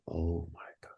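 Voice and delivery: breathless whispered disbelief; stunned whisper. Retold or imitated. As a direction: barely breathe it out, shaken. Stunned by news of death.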